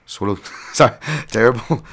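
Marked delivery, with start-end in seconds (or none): 0.1-0.4 mock New Zealand accent. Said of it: "terrible New Zealand accent".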